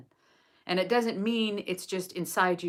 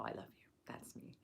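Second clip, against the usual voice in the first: whispered; 20 dB quieter than usual. Real, not acted. Quiet aside about own message.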